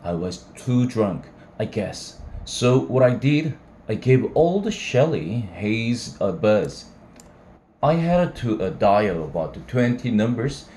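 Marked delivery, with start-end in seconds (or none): none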